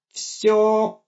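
high pained robot wail; anguished cry. Acted. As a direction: cry out in suffering. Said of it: "страдальчески воскликнул Гоша".

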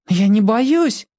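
put-on high, slow stubborn child; small boy voice. A narrator's reading.

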